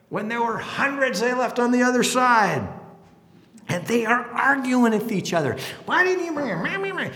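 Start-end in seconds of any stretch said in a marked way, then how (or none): 5.9-7.1 mocking bickering. Disciples bickering over bread.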